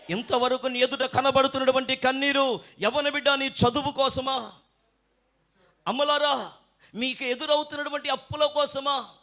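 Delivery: high, projected rhetorical questions; forceful preaching. Real, not acted. Preacher asks mothers and youths why they weep, high and forceful.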